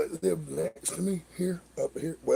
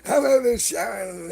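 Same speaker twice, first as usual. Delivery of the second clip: ordinary delivery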